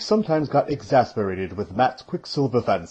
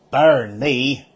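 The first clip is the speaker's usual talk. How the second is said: mock growl, a bit louder; growled curse; 4 semitones higher, 7 dB louder than usual. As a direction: snap out a curse, annoyed. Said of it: "Mat growled".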